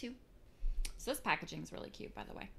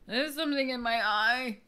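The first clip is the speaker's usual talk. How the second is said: dramatic complaint; 5 semitones higher, 9 dB louder than usual. Real, not acted. Repeats complaint, more dramatic.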